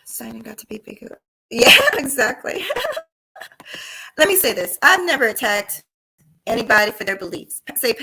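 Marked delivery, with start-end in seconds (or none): 0.0-1.2 unvoiced whisper aside. Real, not acted. 1.5-4.2 laughing agreement, slow. Real, not acted.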